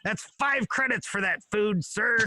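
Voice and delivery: high, odd sci-fi alien voice. Retold or imitated. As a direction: play a spaceship clerk. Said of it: "Is that your alien voice?".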